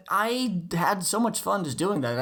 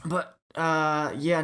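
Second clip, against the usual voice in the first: usual speaking voice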